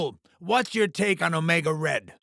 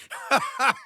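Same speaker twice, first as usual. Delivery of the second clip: short, high chuckle; quick high villain laugh; gleeful laugh; 5 semitones higher than usual. Acted. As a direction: laugh with relish at the carnage. Revels in carnage.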